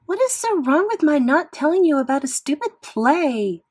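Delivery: put-on teen daughter's angry near-scream, high and loud; practically screaming. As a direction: yell back at mom, defensive and fed up. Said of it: "Via practically screamed".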